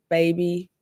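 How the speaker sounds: ordinary talk, nothing marked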